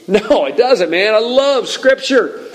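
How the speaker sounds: high, laughing exuberance; delighted. Real, not acted. Preacher tells crowd he loves unsanitized Scripture, delighted. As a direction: gush with real delight, pitch soaring.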